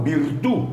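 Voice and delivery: Virtud with no D, stress on the U, rising at the end; Italian virtud. Shown as a demonstration. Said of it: "sin D y con acento en la U".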